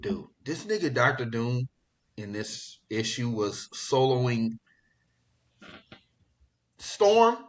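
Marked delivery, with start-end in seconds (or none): none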